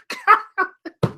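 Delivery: high laugh that falls away; high wheezing laugh. Real, not acted. Vlogger overwhelmed by news, high wheezing laugh. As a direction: laugh until it hurts. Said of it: "I'm laughing, but I'm crying".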